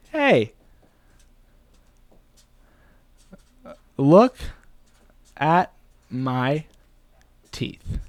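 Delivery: long pauses between words; halting, piece by piece; word-by-word dictation. Real, not acted. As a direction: say each bit slowly as you write it down. Writing the script out aloud.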